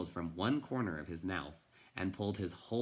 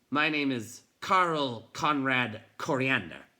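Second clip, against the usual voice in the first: loud, higher, slow self-important; gruff pompous introduction; 6 semitones higher, 8 dB louder than usual. A narrator's reading. Bookseller proudly tells the boy his name, loud and slow. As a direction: announce your name grandly.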